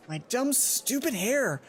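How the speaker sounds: slow, bitter outburst; frustrated. Acted. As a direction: spit out the frustration slowly.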